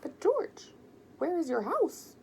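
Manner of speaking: high, loud, amazed question; a little boy who can't believe it; surprised child. A narrator's reading. Ben asks George where his house is, astonished and high. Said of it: "Ben was surprised".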